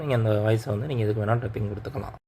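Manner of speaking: ordinary delivery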